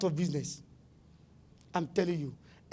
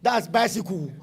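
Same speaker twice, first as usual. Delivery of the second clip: ordinary talk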